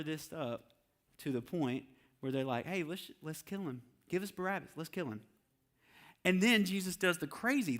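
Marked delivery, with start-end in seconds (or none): 2.7-5.2 mob plotting. Retold or imitated. Crowd turning on Jesus.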